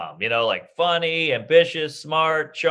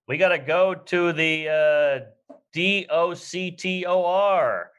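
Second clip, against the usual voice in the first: slow careful spelled letters; spelling it out slowly; 3 semitones higher, much slower than usual. Retold or imitated. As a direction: spell out each letter deliberately. Parent spelling word for wife.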